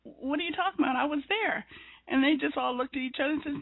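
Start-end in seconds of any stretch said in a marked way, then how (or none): 0.0-1.7 higher, puzzled protest; mock bewildered objection; surprised disbelief. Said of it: "and I said".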